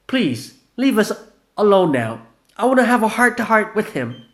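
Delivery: high, loud urgent plea; tense request. Acted. Gina urging the narrator to leave, tense loud voice.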